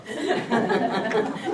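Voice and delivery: high chuckling laughter; laughing. Real, not acted. Host still laughing at the speaker's quip, chuckling. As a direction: keep laughing at the joke.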